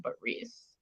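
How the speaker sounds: hushed climactic whisper. Acted. Climax of the quoted confession.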